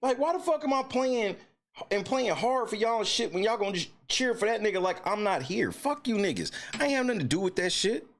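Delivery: high, heated rant in his shoes; mock Anthony Davis rant. Fan ranting as Anthony Davis, high and heated. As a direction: vent as the disrespected player. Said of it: "if I'm Anthony Davis".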